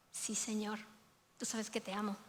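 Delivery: soft, near-whispered confession; humble hushed reply. Acted. Peter answering Jesus's question, hushed and humble. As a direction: barely breathe the answer, humbled.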